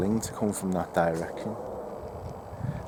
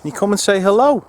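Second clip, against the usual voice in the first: loud, high-pitched call; voice shooting up high; 9 semitones higher, 9 dB louder than usual. Real, not acted. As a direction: call out, voice jumping unexpectedly high. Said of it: "why my voice went that high".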